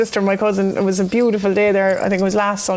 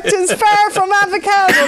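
mock shout; 9 semitones higher than usual. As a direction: bellow it in mock scorn. Mocking dad's avocado.

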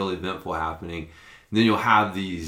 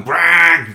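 loud, higher mimicked police burst; imitated siren blast; 7 semitones higher, 11 dB louder than usual. Storyteller imitates the cop pulling him over again, loud blaring noise.

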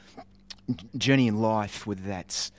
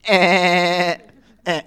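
much higher, very loud zapping "Ah, ah"; ray gun noise; 9 semitones higher, 12 dB louder than usual. Retold or imitated. Boy zapping his sister Sophie, very loud toy blasts. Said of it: "I would just go".

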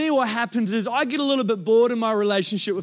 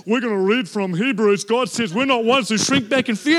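lower, louder preacher voice; imitating the pastor; 3 semitones lower, 3 dB louder than usual. Preacher imitating Glenn reading Hebrews, lower and louder. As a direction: read it out like a solemn pastor.

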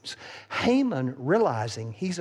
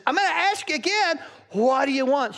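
high, loud, fast question; eager asking; 11 semitones higher, 5 dB louder than usual. Acted. King pressing the queen, high and eager. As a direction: press her playfully.

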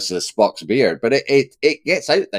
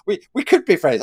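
higher, a touch defensive, stress on "could"; mock defensive; 6 semitones higher than usual. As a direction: lightly play Kirk getting defensive. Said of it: "Kirk suddenly becomes a bit defensive".

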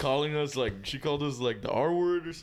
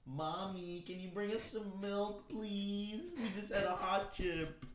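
put-on higher and softer, little-kid begging; childish whiny voice; 7 semitones higher, 8 dB quieter, slower than usual. Voice message to a friend, begging like a small child. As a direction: sound like a small child asking sweetly.

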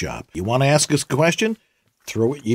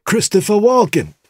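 halting celebrity impression, badly done; a badly faked star impersonation; Christopher Walken; 8 semitones higher than usual. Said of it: "that's a terrible impression".

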